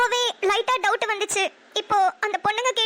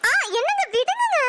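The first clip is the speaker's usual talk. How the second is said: high squealing protest, half laughing; a sudden high giggly shriek of protest; startled squeal; 8 semitones higher than usual. Acted. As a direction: yelp to be let go, playful. Wife squirms as husband holds her.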